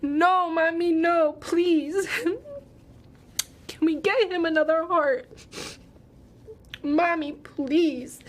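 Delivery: echoing her son begging through tears; pleading. Retold or imitated. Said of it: "started pleading".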